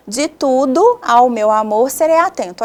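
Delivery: joined-up verse line, vowels run together; flowing, linking "tudo ao" into each other; poetic syllable division. Shown as a demonstration. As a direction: read "De tudo ao meu amor serei atento" as one connected line. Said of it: "Olha só a divisão poética".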